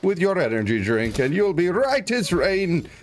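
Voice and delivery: mock shopkeeper, high and slow; hammed-up apothecary. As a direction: play the quaint old apothecary selling snake oil. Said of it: "And I would say something like".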